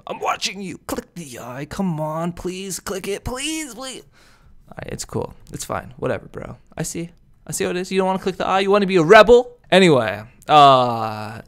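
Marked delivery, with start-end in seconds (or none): 0.0-0.8 high, soft teasing menace; half-playful spooky threat; mock creepy warning. Creepy warning to click eye. 0.9-4.0 soft, high whiny begging; mock pleading. Begging viewers to click. 4.7-8.9 soft, hurt, faked indifference; mock sulk. Fake sulking after no clicks.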